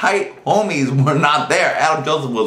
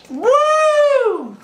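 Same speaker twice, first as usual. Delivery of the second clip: high cheer. Real, not acted.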